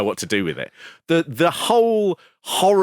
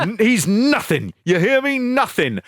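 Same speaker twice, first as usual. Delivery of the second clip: high-pitched contemptuous taunt, imitated; hammed-up gloating put-down; mocking villain; 9 semitones higher than usual. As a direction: pour acted scorn on a beaten rival. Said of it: "[name] is mocking him".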